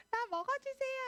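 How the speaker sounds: very high squeaky bird voice; a cute little quail begging to be eaten; quail's voice. Acted. Quail coming down to the people, very high squeaky voice. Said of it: "하고 내려오는 거야".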